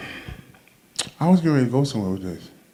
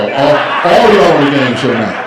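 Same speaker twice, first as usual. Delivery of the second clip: louder laughing repeat; 6 dB louder than usual. Real, not acted. Building repeat of punchline.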